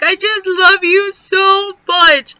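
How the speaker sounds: high, loud, drawn-out tearful wail; weepy, stretched-out declaration of love, playfully exaggerated; mock bawling. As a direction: imitate yourself blubbering with love, hammed up. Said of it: "Why are you crying?".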